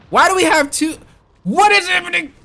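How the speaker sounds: high, loud exasperated shouting; outraged yell. Real, not acted. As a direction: yell at the team in total disbelief. Outraged at team composition.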